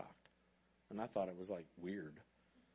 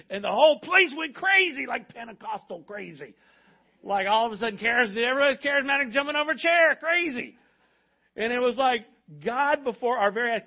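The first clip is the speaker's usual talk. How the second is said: high, loud, exuberant retelling; excited preaching; 9 semitones higher, 11 dB louder than usual. Real, not acted. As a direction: let the joy of the room carry the voice. Church erupts in celebration.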